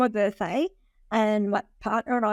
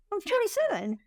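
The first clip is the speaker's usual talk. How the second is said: pitched way up in disbelief; shocked disbelief; 13 semitones higher than usual. Retold or imitated. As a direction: blurt it out high, stunned. Mishearing her own age.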